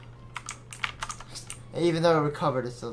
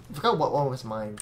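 ordinary talk

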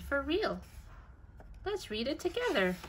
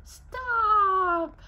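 high, loud cry of stop; shouted stop; 9 semitones higher, 6 dB louder than usual. Acted. Harold the elephant crying out to stop Hog. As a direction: halt him with a sharp shout.

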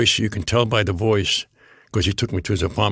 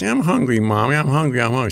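put-on high, whining little kid; whiny hungry child; 5 semitones higher than usual. A two-year-old in the back seat whining to Mommy, high. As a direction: play the small kid begging his mother. Child voiced in car story.

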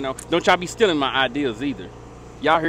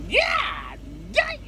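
high shouted yeah; excited shout; 4 dB quieter than usual. Real, not acted. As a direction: yell it out at the mess. Opening trash can, shout.

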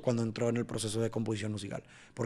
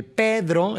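"Pedro" drawn out slowly with PE accented; stress on the beat; 5 semitones higher, 4 dB louder than usual. Shown as a demonstration. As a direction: place the PE of "Pedro" squarely on the beat. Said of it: "el P, lo voy a poner en el primer tiempo".

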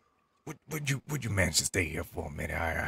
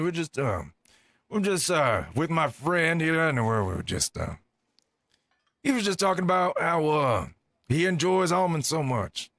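nervous; 8 semitones higher, 4 dB louder than usual. Real, not acted. Nervous high covering excuse.

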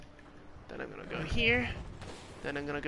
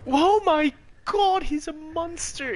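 high, loud shocked cry; panicking gamer; 10 semitones higher, 8 dB louder than usual. Real, not acted. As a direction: shriek in disbelief. Shocked by enemy.

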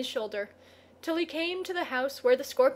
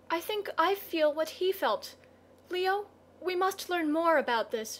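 put-on high, earnest girl's voice; higher, feeling female character; 5 semitones higher than usual. A narrator's reading. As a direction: voice the girl with raised pitch, full of sympathy. Narrator voices the girl.

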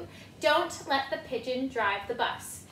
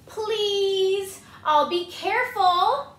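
higher, slow sweet begging; pigeon pleading; 6 semitones higher, 6 dB louder, much slower than usual. Acted. Pigeon promising the kids, slow and pleading.

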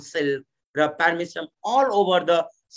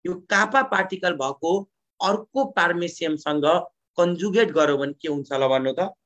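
ordinary talk

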